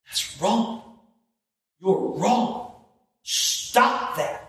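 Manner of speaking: high, emphatic reprimand; stern pastor correcting someone sharply; sharp rebuke. Shown as a demonstration. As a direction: rebuke them in short separate commands. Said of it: "Occasionally sharply".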